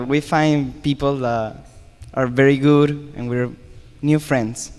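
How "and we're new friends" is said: ordinary delivery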